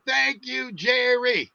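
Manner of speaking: high, drawn-out mock chant; imitated crowd yell. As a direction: imitate tailgaters yelling, stretching the name. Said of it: "they were also yelling".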